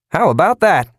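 high, loud, oily gush; oozing salesman. A narrator's reading. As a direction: ooze false delight. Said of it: "rambled on oozingly".

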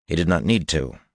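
plain reading voice

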